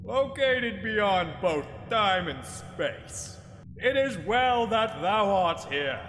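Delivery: slow, booming godlike voice; God voice. Acted. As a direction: intone it slowly like a deity. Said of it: "it's God".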